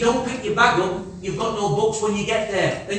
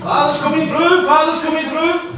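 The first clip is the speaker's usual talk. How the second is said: higher, louder mock alarm call; shouting alarm; 8 semitones higher, 6 dB louder than usual. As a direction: raise the alarm with a laugh. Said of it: "that's my emergency switch".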